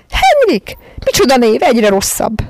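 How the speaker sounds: very high, loud despairing cry; desperate. A narrator's reading. Miss Lark despairing at the name Henrik. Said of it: "kétségbe esetten".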